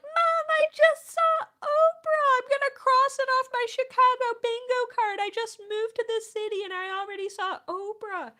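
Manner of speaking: high, loud squeal easing down slightly; overexcited, high-pitched gushing; excited shrieking. Retold or imitated. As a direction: shriek the big news to mom. Acting excited phone call to mom.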